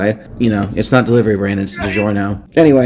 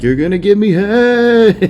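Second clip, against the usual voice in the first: slow, higher, menacing growl of a pursuer; menacing chase; 11 semitones higher, 4 dB louder, slower than usual. Retold or imitated. Seth chasing Dwayne through the house, slow and menacing. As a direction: drag the words out like a creep closing in. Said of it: "He's like".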